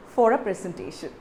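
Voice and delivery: usual speaking voice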